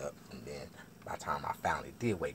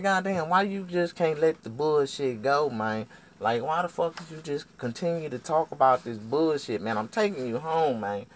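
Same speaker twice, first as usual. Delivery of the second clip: loud, high complaint; acted fed-up protest; exasperated arguing; 10 semitones higher, 8 dB louder than usual. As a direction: argue back, fed up with the nagging. Acted quarrel, man pleading back.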